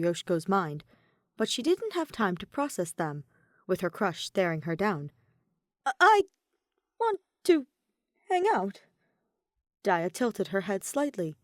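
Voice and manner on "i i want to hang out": high, loud, faltering blurt; nervous stammer. Acted. Yoshiko stammering her invitation at her crush, high and nervous. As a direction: force the words out past panic.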